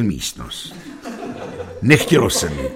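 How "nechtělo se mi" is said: plain reading voice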